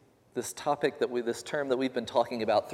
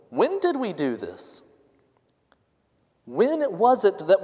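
humble protest; 9 semitones higher, 3 dB louder than usual. Retold or imitated. Humble asking Jesus, raised pitch.

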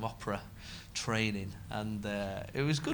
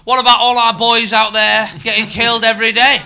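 much higher and louder indignant retort; mock outrage; 11 semitones higher, 19 dB louder than usual. Online critic, loud and high, scolding the comic. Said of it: "And somebody said".

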